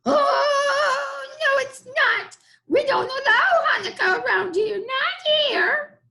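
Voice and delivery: high, loud squeaky goblin forbidding it; a pesky goblin laying down the law. Acted.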